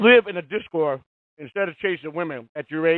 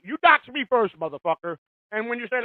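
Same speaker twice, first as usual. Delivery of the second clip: normal talking voice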